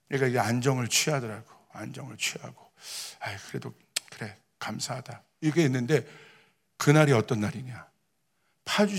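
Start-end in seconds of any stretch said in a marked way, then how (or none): 3.2-5.2 low, hushed self-talk; quiet inner thanks. Retold or imitated. Father to himself as his daughter calms, quietly thankful. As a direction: murmur it softly to yourself.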